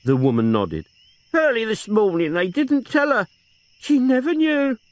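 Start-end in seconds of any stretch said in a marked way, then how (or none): 1.3-4.8 put-on higher old woman's voice, grieving; a lightly tearful old aunt. Aunt mourning her nephew and sister, tearful.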